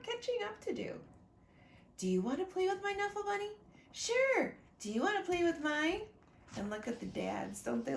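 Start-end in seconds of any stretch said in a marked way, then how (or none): 2.0-3.5 put-on high, fast little-girl offer; high quick child voice. Trixie asking Sonia to play, high and fast. 3.9-4.6 put-on high, fast little-girl offer; high quick child voice. Trixie asking Sonia to play, high and fast. 4.8-6.0 put-on higher, fast eager child question; high quick child voice. Sonia offering her bunny, high and quick.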